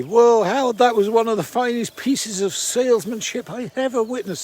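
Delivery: loud, high-pitched Scottish impression; booming Scottish voice, pitched well up; Scottish accent. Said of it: "not doing a very good Scottish accent".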